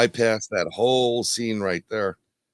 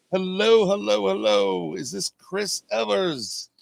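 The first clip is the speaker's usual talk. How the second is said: shouting into a phone; 8 semitones higher, slower than usual. Real, not acted. Calling out to caller on phone.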